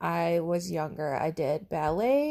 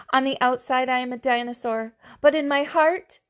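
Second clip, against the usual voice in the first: put-on louder, higher children's cry; children cheering the dinosaur on, higher and louder; 5 semitones higher, 6 dB louder than usual. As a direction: call it out proudly like kids. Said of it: "cried Clara and Jack".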